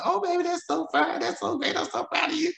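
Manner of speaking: high, sweet delighted mother; mom's proud reply. Retold or imitated. Son retells mom's delighted phone reply, high and gushing. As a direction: gush like a proud mama.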